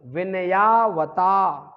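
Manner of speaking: "विनयावता" loud, long vowels, falling end; loud slow model of "विनयावता"; repeat-after-me dictation. Shown as a demonstration. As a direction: stretch "विनयावता" syllable by syllable, loudly. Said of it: "इसको पूरा repeat करिए".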